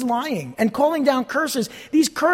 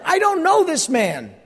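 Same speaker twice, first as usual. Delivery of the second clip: voicing Peter; 7 semitones higher than usual. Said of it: "He's telling everybody who's there".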